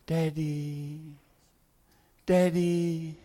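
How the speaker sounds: softly imitated child's faint call, drawn out; small, held-out acted cry for a parent. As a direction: call out as a trapped child heard through rubble. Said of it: "he heard".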